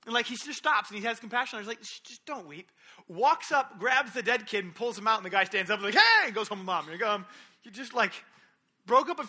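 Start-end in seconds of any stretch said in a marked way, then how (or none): 1.8-2.7 hushed and much softer, tender. Retold or imitated. Jesus hushing the weeping widow, soft and tender. 5.9-6.2 high, loud burst of joy; sudden cheer. Retold or imitated. Dead son jumping up alive, loud joyful hey.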